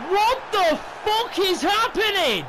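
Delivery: high, loud, slow furious yell; furious shout. Real, not acted. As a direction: roar it out in disbelief. Furious at his team's play.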